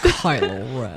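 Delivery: low laughing villain intro; deep Kylo Ren. Pretend. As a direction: growl it slowly and deep.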